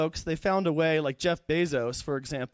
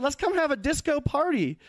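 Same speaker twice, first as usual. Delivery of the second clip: high, excited Southern-accent mimicry; swampy Southern accent; 10 semitones higher than usual. Couple luring the comic to party, high Southern accent. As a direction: propose a party in a mock Southern twang. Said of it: "they had, like, a swampy southern accent".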